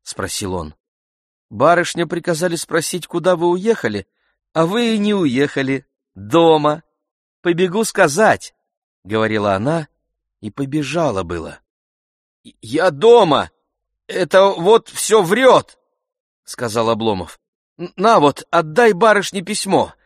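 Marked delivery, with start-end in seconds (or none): 1.5-8.5 high, lively messenger maid, eager to report back; young lady's maid. A narrator's reading. Narrator voices the maid Katya. 12.6-15.8 high, loud, indignant denial; flustered protest. A narrator's reading. Master hastily contradicts servant's lie. 17.8-19.9 high, loud, hurried-sounding order with a stumble; flustered urging. A narrator's reading. Flustered master hands maid letter.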